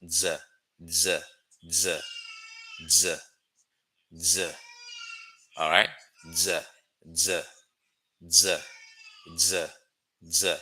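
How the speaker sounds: buzzing Z repeated; teacher drills the z sound. Shown as a demonstration. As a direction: hold the Z buzz. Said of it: "say z".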